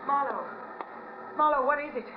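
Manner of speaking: high, rising alarm as she calls out; alarmed calling. Acted. Woman calling the detective at a crime scene, alarmed. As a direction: call out in fear for him.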